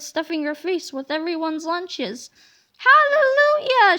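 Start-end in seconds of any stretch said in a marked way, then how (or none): 2.8-4.0 high, loud rejoicing shout; joyful cry. Acted. Miss Labeler rejoices over free food, loud joyful cry. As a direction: cry it out in delight. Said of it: "she cried".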